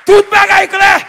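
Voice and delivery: high, ringing proclamation; triumphant preaching shout. Real, not acted. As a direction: proclaim the good news at full force. Preacher repeats the news triumphantly.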